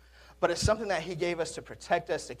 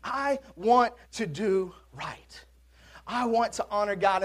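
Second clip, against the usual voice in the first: inner voice; 3 semitones higher, slower than usual. Acted. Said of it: "a voice inside of you compelling you".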